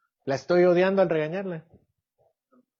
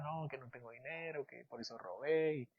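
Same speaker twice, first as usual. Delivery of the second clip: soft, hushed begging of a sorry thief; a hint of tearful pleading; lightly acted tearful thief; mock tearful plea; 2 semitones lower, 11 dB quieter than usual. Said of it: "Imagínense que se pone a llorar".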